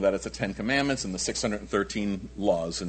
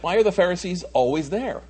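usual speaking voice

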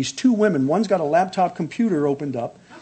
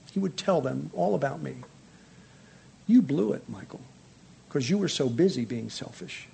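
quiet still voice; 2 semitones lower, 8 dB quieter than usual. Retold or imitated. God speaking to Michael in a quiet, still voice. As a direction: speak it softly, like God whispering to the heart. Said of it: "he spoke in a kind of this quiet, still voice".

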